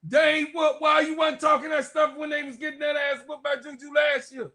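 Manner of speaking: shouting at a bragging fan. Real, not acted. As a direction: yell him down. Trash talking rival fan.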